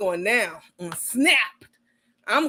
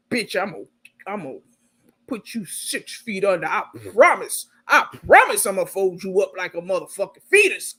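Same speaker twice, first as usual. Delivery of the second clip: menacing Carmen; 3 semitones lower than usual. Retold or imitated. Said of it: "Carmen be like".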